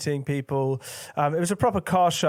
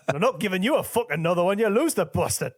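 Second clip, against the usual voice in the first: put-on gruff Geordie rock-star accent, higher and faster; 6 semitones higher, faster than usual. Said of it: "I like the way your accent changed".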